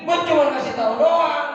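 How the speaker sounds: lightly mock-annoyed Betawi slang; Betawi paraphrase. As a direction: toss it off like a local who can't be bothered. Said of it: "Kalau bahasa Betawi begini".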